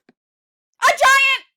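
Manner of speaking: sudden high frightened shout; scared cry. A narrator's reading. Tiny seed shrieking at a looming giant, frightened shout. Seed sees a huge human.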